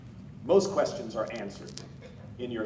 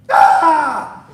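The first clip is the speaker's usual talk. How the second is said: high loud shotgun death scream; 18 dB louder than usual. Shown as a demonstration. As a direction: scream like you're shot. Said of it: "death screams".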